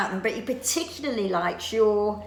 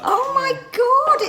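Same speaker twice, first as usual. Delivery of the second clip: surprised; 5 dB louder, much slower than usual. Real, not acted. Shocked at being recognised.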